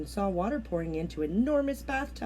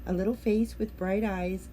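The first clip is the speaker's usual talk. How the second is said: plain reading voice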